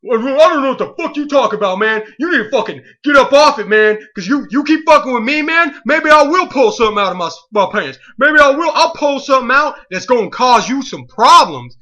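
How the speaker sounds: mock threatening teen. Teen threatening the clerk over the beer, hammed-up bluster. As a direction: threaten him, puff it up. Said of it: "what are you thinking right now".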